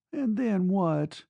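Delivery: high, slow, weary question; weary demand. A narrator's reading. Girl questioning her departing lover, slow and weary. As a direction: drag the question out high and wearily. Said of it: "she demanded wearily".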